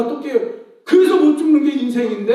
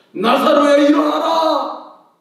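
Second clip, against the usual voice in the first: loud, slow, higher call; commanding call; 4 semitones higher, 4 dB louder, slower than usual. Acted. Jesus calling Lazarus from the tomb, slow loud command. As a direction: call the dead man to rise, drawn out.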